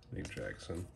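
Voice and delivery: ordinary talk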